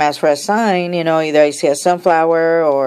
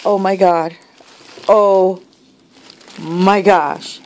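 ordinary talk

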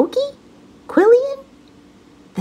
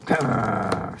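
low rumbling growl, way down; deep gruff beast growl; rhino growl; 11 semitones lower than usual. Acted. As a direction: growl it like a big grumpy rhino. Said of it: "said the rhinoceros".

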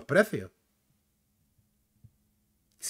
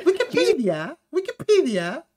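high, rising mock British question; prissy British; 11 semitones higher, slower than usual. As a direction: mimic an affected British accent, lifting the ending. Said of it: "Suena como repipi".

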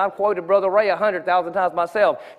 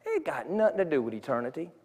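much softer and lower, talking to God inside; quiet inner prayer; 7 semitones lower, 10 dB quieter than usual. Retold or imitated. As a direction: murmur it as a private prayer. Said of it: "And I thought".

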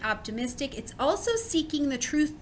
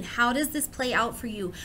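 usual speaking voice

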